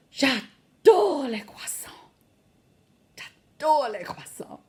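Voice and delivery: slow swooning French; breathless adoration. Shown as a demonstration. Teacher modelling adoring croissants, swooning. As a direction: draw it out, swooning.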